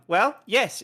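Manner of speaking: ordinary talk, nothing marked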